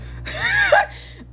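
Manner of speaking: high giggle breaking out; real burst of laughter. Real, not acted. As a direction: crack up mid-explanation. Cracks up mid explanation.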